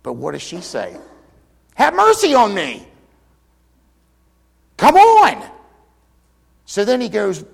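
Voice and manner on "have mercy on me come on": much higher and louder, pleading hard; mother refusing to take no, pleading louder; insistent plea. Retold or imitated. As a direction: push past the refusal, begging.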